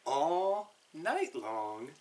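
ordinary delivery, nothing marked

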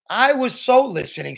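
high-pitched, insistent comeback; defensive retort. A narrator's reading. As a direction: argue back like a contradicted kid. Julian argues back.

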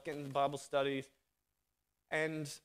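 ordinary delivery

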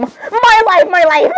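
high gushing exclamation; excited outburst. Acted. Character gushing over a favourite guy, excited. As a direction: stammer into a high, giddy cry. Acted fangirl outburst in roleplay.

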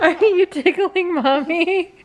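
laughing through being tickled; laughing while tickled. Real, not acted. Toddler tickling mom, laughing.

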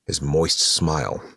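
usual speaking voice

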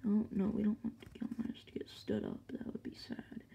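quick whispered worry. Real, not acted. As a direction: hush it like a secret aside. Whispered aside about toy falling.

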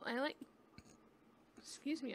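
a burp cutting into the words. Real, not acted. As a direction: talk through a small burp. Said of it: "I'm burping".

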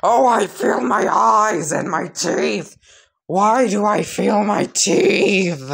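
high, loud wail of pain; agonized scream. Acted. Sludge villain as his eyes boil, high agonized screaming. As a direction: cry out in hammed-up agony, stretching the last words. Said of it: "continue to scream in absolute agony".

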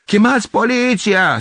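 high, loud, slow-drawn yell; sharp female shriek from a window; shrill woman's voice. A narrator's reading. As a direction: screech for help as an alarmed woman. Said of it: "o voce ascuțită de femeie".

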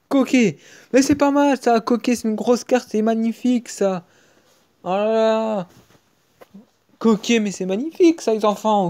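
high and loud, thrilled at a great card; real delighted pack-opening cheer; excited. Real, not acted. Good card pulled in pack.

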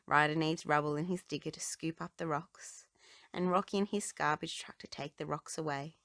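ordinary delivery

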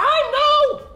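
sudden high-pitched no; high cry of dismay. Real, not acted. Ball going wrong.

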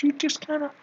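ordinary talk, nothing marked

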